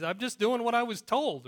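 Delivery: high, loud, put-upon self-defense; protesting poor guy. Acted. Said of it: "So the poor guy".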